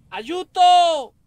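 high loud cry for help; being chased. Real, not acted. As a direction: wail for help while fleeing. Chased by the witch.

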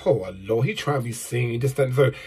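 low mocking imitation of haters. Haters grumbling about the singer at the funeral, mocking. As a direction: drop low to mimic a snide critic. Quoting haters' snide remarks.

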